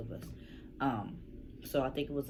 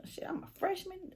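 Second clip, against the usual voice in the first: high-pitched self-satisfied boast; hum, then boast way up high; retold cockiness. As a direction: play a younger self showing off.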